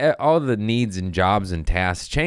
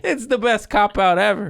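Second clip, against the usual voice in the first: high, loud through laughter; laughing; 4 dB louder than usual. Real, not acted. Comic laughing at his own excuse, high laughing. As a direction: crack up saying it.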